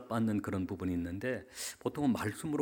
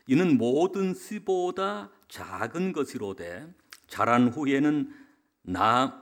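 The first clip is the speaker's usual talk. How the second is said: slow, raised, declamatory Bible reading; scripture reading; 4 semitones higher, 6 dB louder, slower than usual. Real, not acted. Pastor reading Matthew to the congregation, slow and declamatory. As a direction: proclaim the verse slowly, settling at the close. Reads a Bible verse aloud.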